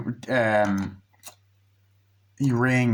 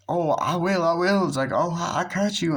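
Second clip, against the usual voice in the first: higher, teasing voice; flirty friend; 7 semitones higher than usual.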